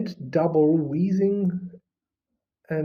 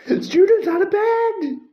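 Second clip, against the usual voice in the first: high, loud alarm bellow; bellowed high and loud, STUDENTS hit first; 14 semitones higher, 7 dB louder than usual. A narrator's reading. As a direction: raise the alarm at the top of the lungs. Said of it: "Peeves bellowed".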